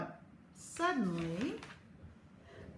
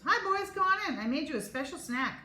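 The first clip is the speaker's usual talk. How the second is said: bright, higher mom voice; 7 semitones higher, 3 dB louder than usual. Acted. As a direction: sing out a cheerful greeting.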